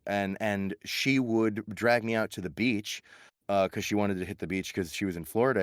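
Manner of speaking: normal talking voice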